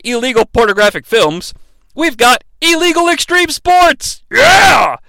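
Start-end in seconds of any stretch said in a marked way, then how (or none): 1.9-5.0 hyped shout. Acted. Narrator hyping the street luge to viewers, a big excited shout. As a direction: sell it like an extreme sports promo. Hyping extreme sports reveal.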